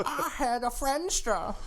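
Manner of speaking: high, slow geezer voice; hammed-up high elderly voice; mock old-timer. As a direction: boast like a cartoon oldster, amused. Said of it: "Showing Your Age Via References Hour".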